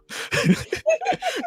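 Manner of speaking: high burst of real laughter. Real, not acted. Laughing at the Twitter mix-up, high real laugh. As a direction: crack up high.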